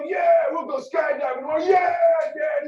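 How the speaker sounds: loud, high whooping yeah; drunken cheer. Retold or imitated. Friend at the bar agreeing to skydive, whooping loud and high.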